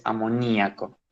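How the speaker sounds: "amoníaco" with a clearly stressed í. Shown as a demonstration. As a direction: break "amoníaco" as a-mo-NÍ-a-co, weight on NÍ. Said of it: "con acento en la I".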